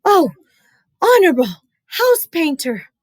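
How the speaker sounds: put-on high gasping boy; breathless plea. Chen reaching the painter, breathless high plea. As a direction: gasp out the plea. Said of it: "Chen cried".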